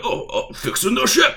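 high goofy orc reply. Retold or imitated. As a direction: answer like a clueless orc. Orc answers casually.

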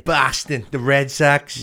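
a stab at a Boston accent; broad Boston vowels on the place names. Shown as a demonstration. Trying the accent for a friend, Boston accent. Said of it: "why can I only say place names in the accent".

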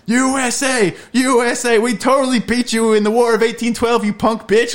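loud, high-pitched chanting jeer; bully them with a hammed-up rowdy cheer; imitating obnoxious flag-waving Americans, shouted high; mock patriotic taunting. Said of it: "bully the, the British guys".

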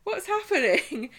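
high-pitched mock wail, half laughing; hammed-up tearful high voice; self-pitying whine. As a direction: whine it like a neglected sulker. Acted self-pitying Chesney, hammed up.

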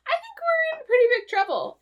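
high-pitched put-on voice; Chekhov. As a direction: squeak it out as a hammed-up crewman. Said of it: "And Chekhov's like".

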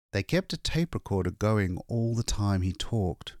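plain reading voice

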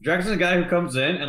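ordinary talk, nothing marked

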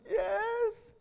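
high, teary little yes; tearful toddler. Retold or imitated. Father voicing his crying son, high teary yes. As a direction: answer like a sobbing small boy.

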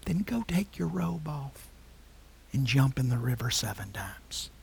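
soft, unvoiced whispered order; hushed command. Prophet whispering to Naaman, telling him to bathe.

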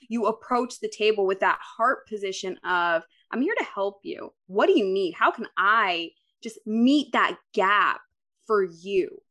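ordinary talk

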